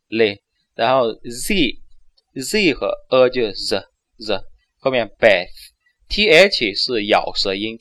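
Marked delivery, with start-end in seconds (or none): 0.1-4.4 "嘞" and "Z" said slowly and separately; syllable by syllable. Shown as a demonstration. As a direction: break Elizabeth into sounds slowly. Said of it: "L I就是嘞". (慢速拆音节示范)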